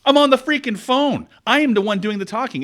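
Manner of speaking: a guy retelling how he told off a rude coworker, annoyed; retold angry outburst. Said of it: "and I said".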